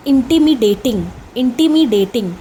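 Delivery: "इंटिमिडेटिंग" with the first vowel said as a short ee. Shown as a demonstration. Teacher models "इंटिमिडेटिंग" for students, ee sound first. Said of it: "आई को ई की तरह use कर रहे हैं".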